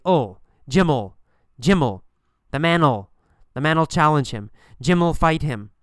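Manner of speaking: "Jim'll" run together as "Jim-ol"; contracted will. Shown as a demonstration. Teacher modelling normal speech, reduced "Jim'll". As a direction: attach "ol" straight onto "Jim" and "man". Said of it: "We say".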